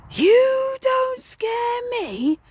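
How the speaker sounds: very high, very slow, drawn-out wail; spirit's eerie taunt. Retold or imitated. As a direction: stretch it into a ghostly sing-song. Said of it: "looked at me and he said".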